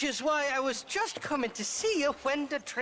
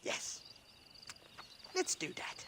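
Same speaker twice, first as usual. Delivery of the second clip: whisper; 4 semitones higher, 12 dB quieter than usual. Acted. Drunk agreeing under his breath.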